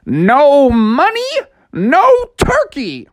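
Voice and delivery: high, loud, slow refusal; emphatic cartoon storekeeper; gruff shopkeeper role. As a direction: stretch out each refusal at high pitch. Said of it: "guy behind the counter said".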